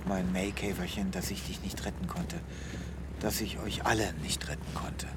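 quiet, broken whisper. Acted. Vet apologizes to Yamei, fading whisper. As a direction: let it fade into a whisper.

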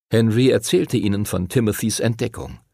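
plain reading voice

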